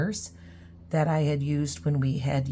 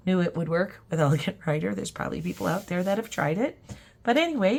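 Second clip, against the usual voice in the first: normal talking voice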